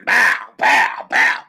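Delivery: loud, high "bow" bursts; imitated dropping noise. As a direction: punch out each sound effect. Imitates food dropped into grease.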